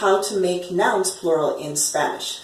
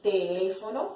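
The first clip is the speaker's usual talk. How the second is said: "Teléfono" with the stress on "lé"; stress on accented E; 7 dB quieter than usual. Shown as a demonstration. Teacher modelling the accent mark for students, "Teléfono" stressed on "lé". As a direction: lean on the second syllable of "Teléfono". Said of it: "accent mark tells you to stress the E".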